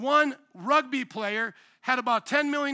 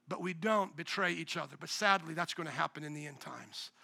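much softer and lower; solemn hush; 6 semitones lower, 7 dB quieter than usual. Real, not acted. Pastor warning of end times, solemn and hushed. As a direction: drop to a grave hush.

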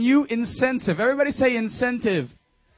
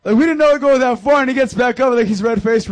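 excited; 8 dB louder than usual. Real, not acted. Excited story climax.

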